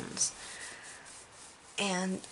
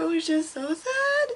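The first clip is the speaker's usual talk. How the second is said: high, louder lament; lingering upset; 11 semitones higher, 3 dB louder than usual. Real, not acted. Vlogger still upset after Spider-Man scene, lamenting. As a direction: still shaken, say how sad it was.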